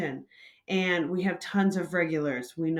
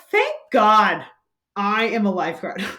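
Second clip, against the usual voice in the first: louder, emphatic thank God; relieved exclamation; 8 dB louder than usual. Retold or imitated. Barista grateful for her lifeguard training, louder exclamation. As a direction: burst out in relief.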